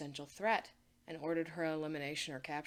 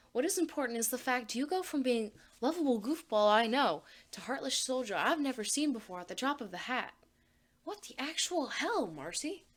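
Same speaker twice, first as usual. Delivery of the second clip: exasperated; 5 semitones higher, 4 dB louder than usual. Acted. Anne exasperated tirade.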